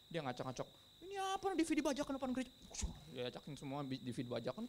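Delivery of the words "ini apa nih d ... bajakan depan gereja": high, rapid-fire comic complaint; squeaky-high hammed-up telling-off; mock scolding. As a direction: rattle it off in a high, fussy mock voice. Mimics Jesus scolding street vendors.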